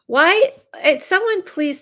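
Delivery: sudden high, loud outburst; real annoyed surprise; exasperated why. Real, not acted. App blocks her view.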